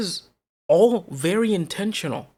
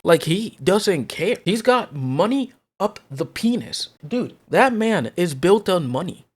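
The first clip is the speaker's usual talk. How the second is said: louder and louder, fired up; escalating comedy rant; 3 semitones higher, 3 dB louder than usual. Real, not acted. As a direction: build the rant to a loud peak. Rant escalates against Linus.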